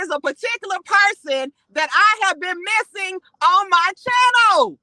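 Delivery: incensed, building to outrage; indignant rant. Real, not acted. Ranting at a guest.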